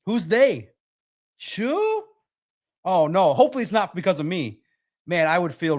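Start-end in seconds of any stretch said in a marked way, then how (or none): 1.4-2.0 sarcastic high sure. Pretend.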